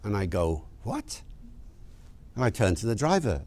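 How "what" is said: high, hushed, rising question; small high-pitched puzzled query; baffled reaction. Acted. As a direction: squeak it softly in disbelief. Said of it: "Like that".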